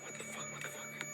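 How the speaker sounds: scared breathless whisper; hushed, frightened whisper. Real, not acted. Scared in dark haunted building.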